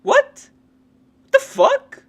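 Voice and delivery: very high, loud disbelieving yelp; shocked outburst. Real, not acted. Outraged at guest's remark.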